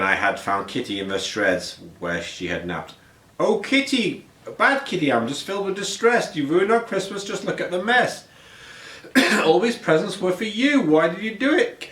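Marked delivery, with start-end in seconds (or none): 3.4-11.9 high, upset scolding of the cat; scolding owner voice. A narrator's reading. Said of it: "And Kitty said".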